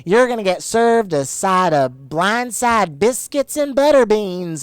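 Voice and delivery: slow, high, loud fake Southern drawl; imitating a fake Southern accent, slow and loud; mock Southern accent. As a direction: ham up a reality star's put-on Southern accent. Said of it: "fake Southern accent that she really puts on".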